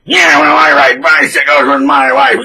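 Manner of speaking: put-on snubbing old friend, loud and curt; mock dismissive friend. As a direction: bark it back dismissively. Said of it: "He's like".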